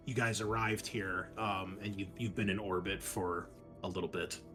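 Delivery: usual speaking voice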